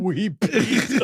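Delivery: slow words through laughter; giggling while saying it; laughing. Real, not acted.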